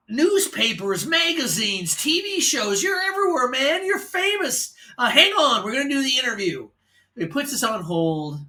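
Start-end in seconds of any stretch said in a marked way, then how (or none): 0.1-6.7 much higher excited radio host; disc jockey voice. Retold or imitated. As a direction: list it off like a hyped DJ. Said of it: "disc jockey sounding voice".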